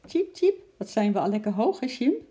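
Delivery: high, chirpy little-bird voice. Acted. Eki the bird calls to Sjimp, high and chirpy. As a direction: squeak it out proudly from up high.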